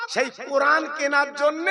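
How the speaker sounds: ordinary delivery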